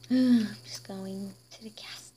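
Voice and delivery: hushed resigned aside; whispered aside leaving the castle; sighing whisper. Acted. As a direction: whisper it after a sigh.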